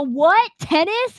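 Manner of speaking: puzzled, rising "Tennis?" said high like a stranger mishearing her name; confused mishearing. Retold or imitated. Stranger mishearing her name, asking back "Tennis?" with a high puzzled rise. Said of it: "and then they go".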